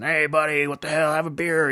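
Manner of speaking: high, boisterous bar-guy voice; loud dude. Acted. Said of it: "some loud dude walks in".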